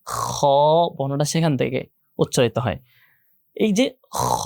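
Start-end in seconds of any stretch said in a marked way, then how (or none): 0.1-0.4 soft, drawn-out খ scraped like clearing spit; throaty heavy kho. Shown as a demonstration. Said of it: "এই সাউন্ডটা যেখান থেকে আসে". 4.2-4.4 drawn-out খ scraped in the throat; throaty heavy kho. Shown as a demonstration. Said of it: "এটা কিন্তু খা হবে না".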